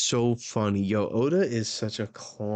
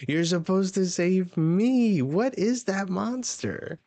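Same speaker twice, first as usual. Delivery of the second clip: high, wailing cartoon distress; panicked plea; 8 semitones higher, slower than usual. Two friends each beg the other for rescue, high acted wail. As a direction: wail it high, acting scared and indignant.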